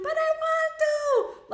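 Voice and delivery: put-on high drawn-out whiny kid scream; screaming child. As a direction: wail it like a child mid-tantrum. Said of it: "They start screaming".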